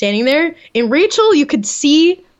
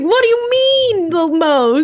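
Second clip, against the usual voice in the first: high, drawn-out mock disbelief; incredulous outrage; 6 semitones higher, slower than usual. Podcaster voicing a woman told he'll pick his favorite, incredulous. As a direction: stretch it out high, as if appalled.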